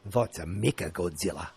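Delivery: put-on low-pitched New Zealand accent. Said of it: "That's my New Zealand accent".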